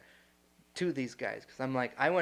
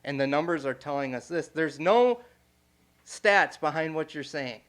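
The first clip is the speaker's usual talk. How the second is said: raised, louder mock anger at the speaker; heated retold question; 2 semitones higher, 5 dB louder than usual. Retelling how he challenged the speaker, raised and louder. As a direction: press him hard on the numbers, voice up and louder.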